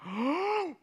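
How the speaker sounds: high, hammed-up gasp of surprise on "Oh"; preacher mocking a shocked God in heaven, high mock surprise. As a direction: play God pretending to be scandalised. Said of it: "He's not like".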